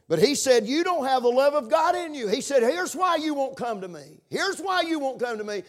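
high, loud, ringing preacher's quote; preaching shout. Acted. Preacher to congregation, loud fervent quote.

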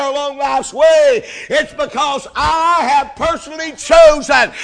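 preaching at full force. Acted. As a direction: build it up to a fiery peak. Preaching climbs to a shout.

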